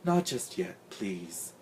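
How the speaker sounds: very soft, fading plea; tired, weak little voice; weakly. A narrator's reading. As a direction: beg feebly, trailing down. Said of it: "Big Dot said weakly".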